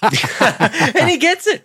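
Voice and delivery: very high squeaky voice. Pretend. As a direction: go up into a tiny squeak.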